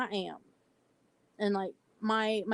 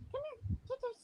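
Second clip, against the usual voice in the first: pet voice; 12 semitones higher than usual. Real, not acted. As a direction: coax the pet sweetly, way up high. Calling her pet over.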